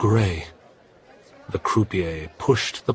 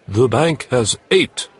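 croupier announcing; 5 semitones higher, 6 dB louder than usual. Acted. Croupier announces the bank's total.

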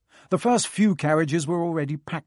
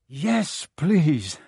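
out of breath; 3 semitones higher, 4 dB louder than usual. A narrator's reading. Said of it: "Harry panted".

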